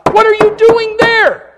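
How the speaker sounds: high, loud crying plea, slowed down; pleading shout with a break in it; anguished cry. Real, not acted. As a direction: cry it out to them. Preacher's anguished plea to congregation.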